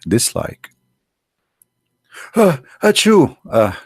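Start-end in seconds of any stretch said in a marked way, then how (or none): 2.4-3.8 a loud, high, hammed-up sneeze; fake sneeze. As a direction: fake a big sneeze to make the point. Fakes a sneeze for likes.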